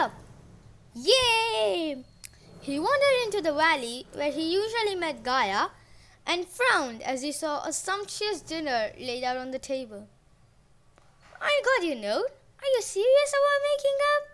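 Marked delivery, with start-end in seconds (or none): 0.9-2.0 high bright cheer; excited cheer. A narrator's reading. Narrator cheering. 11.4-14.2 high, fast hopeful voice; higher god voice. A narrator's reading. Uranus voiced in story.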